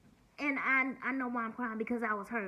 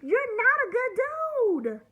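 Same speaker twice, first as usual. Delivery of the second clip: loud, slow, higher shout; angry retort; 12 semitones higher, 9 dB louder than usual. Real, not acted. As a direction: hammer back at him, word by word. Shouting back at Bob.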